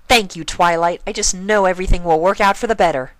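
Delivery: perky and upbeat; Pinkie Pie voice. Acted.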